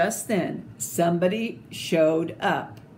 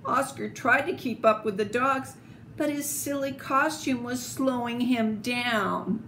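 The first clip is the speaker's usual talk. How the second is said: usual speaking voice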